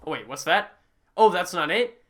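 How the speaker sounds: ordinary delivery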